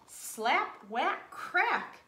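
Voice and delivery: plain reading voice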